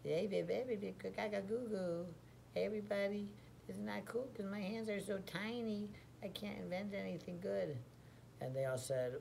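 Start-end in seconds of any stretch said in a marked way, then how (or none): 0.0-7.8 put-on high babbling baby voice; funny baby voice. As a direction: coo like a baby who wants more. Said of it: "I talk, like, real funny".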